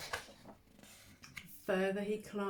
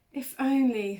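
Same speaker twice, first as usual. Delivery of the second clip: higher, louder wishful mouse voice; wistful little mouse; 5 semitones higher, 9 dB louder than usual. Acted. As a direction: sigh it like a tiny mouse longing. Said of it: "thought Mouse".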